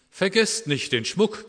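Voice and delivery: high, loud, slow magpie croak. A narrator's reading. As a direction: squawk it out like a magpie. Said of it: "krächzte die Elster". (hoch und laut krächzend wie eine Elster)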